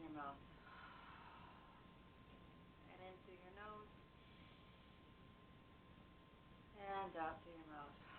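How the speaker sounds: soft relaxation coaching voice; guided breathing. Acted. Leads a breathing exercise.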